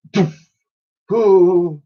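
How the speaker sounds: big high huffing sigh; mammoth sigh. Acted. Mammoth landing on the ice, heavy sigh. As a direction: puff it out like a giant beast. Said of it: "she sighed".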